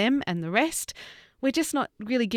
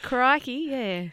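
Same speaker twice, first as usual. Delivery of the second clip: higher-pitched mock Aussie exclamation; 5 semitones higher, much slower than usual. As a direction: toss out a cliché Australian catchword. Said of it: "They think that's enough".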